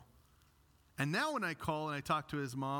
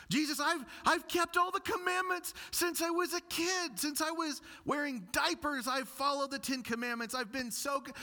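put-on much higher, boastful young man; rich young ruler; 12 semitones higher, 3 dB louder than usual. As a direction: puff up like a proud young man pleading. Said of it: "hear this in this young man's voice".

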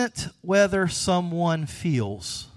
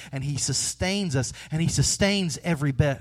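ordinary delivery

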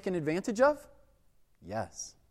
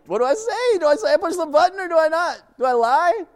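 high, loud and fast panic; frantic inner questions, racing; panicked; 11 semitones higher, 7 dB louder than usual. Retold or imitated. Teen cashier panicking as police flood the shop.

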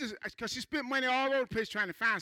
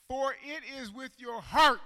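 slow emphatic reread; 4 semitones higher, 4 dB louder, slower than usual. Shown as a demonstration. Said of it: "Now watch this".

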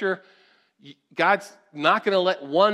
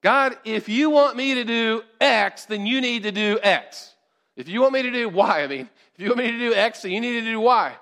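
bargaining believer; 5 semitones higher, 6 dB louder than usual. Acted.

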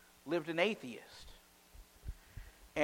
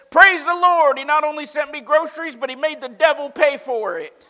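very loud, high, exultant praise; lady rejoicing at the neighbor's expense; jubilant; 11 semitones higher, 15 dB louder than usual. Acted. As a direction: jump and clap as you shout it. Lady jumping, clapping, rejoicing.

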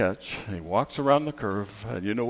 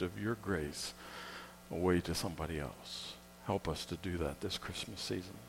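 soft, hushed prayer; closing prayer; 3 semitones lower, 8 dB quieter than usual. Acted. Pastor closing the Christmas service in quiet prayer. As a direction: pray gently over the people.

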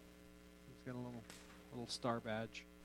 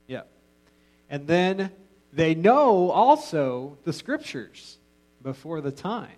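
ordinary talk, nothing marked

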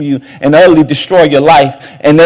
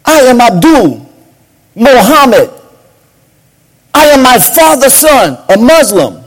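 proud stranger; 9 semitones higher, 5 dB louder than usual. Retold or imitated. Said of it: "He said".

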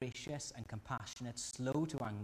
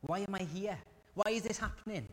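crying out to God in confusion, pitch rising; bewildered believer's lament, rising toward a cry; pleading questions to God; 7 semitones higher than usual. Retold or imitated. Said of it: "we can come to God and we can say".

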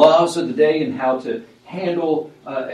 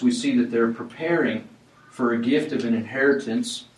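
ordinary talk, nothing marked

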